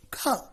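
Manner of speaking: "Kha" as an aspirated k with a short vowel; C sounded as Kha. Shown as a demonstration. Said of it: "exact pronunciation of C is".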